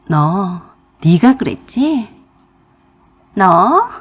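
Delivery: usual speaking voice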